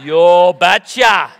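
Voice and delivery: put-on slow, loud, thick Norwegian accent. Imitating the Norwegian father-in-law agreeing to the deal, in a slow, loud Norwegian accent. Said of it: "in his thick Norwegian accent".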